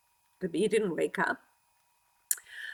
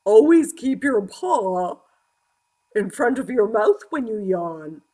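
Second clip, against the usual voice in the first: owl voice; 6 dB louder than usual. A narrator's reading. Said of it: "hooted the owl".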